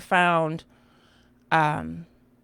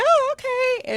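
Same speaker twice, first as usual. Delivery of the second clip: very high, startled oh; puzzled squeak at the stares; startled by both girls staring, high oh; confused surprise; slower than usual. Retold or imitated. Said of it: "And I'm like".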